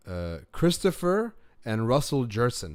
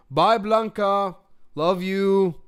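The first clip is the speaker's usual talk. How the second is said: loud, high, drawn-out farewell call; sing-song goodbye to someone at the door; 9 semitones higher, 9 dB louder, slower than usual. Real, not acted. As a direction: call it across the house. Calling goodbye to someone leaving.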